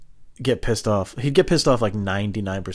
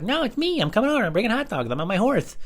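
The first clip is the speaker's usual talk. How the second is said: put-on high, squeaky twelve-year-old boy; prank-calling kid John, pitched way up; 12 semitones higher than usual. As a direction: play a pesky little kid on the phone. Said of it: "call up as this guy, John".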